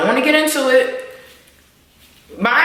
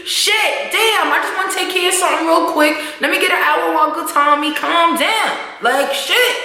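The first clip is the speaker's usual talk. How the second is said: Tariq's protest; 6 semitones higher than usual. Retold or imitated. Tariq protesting to his mother.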